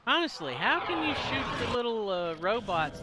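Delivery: incredulous gamer ranting at the game; indignant rant. Real, not acted. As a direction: throw the question at the game in disbelief.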